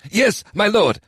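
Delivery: high crisp yes; smart reply. A narrator's reading. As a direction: answer promptly and eager. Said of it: "stepping up smartly".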